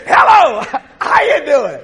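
hammed-up hearty hello; casual chat with God. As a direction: greet the Lord like a buddy. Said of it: "I just talk to the Lord like that".

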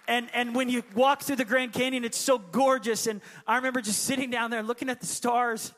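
loud, rising preacher's cry; impassioned pulpit declaration; preaching at full force. Real, not acted.

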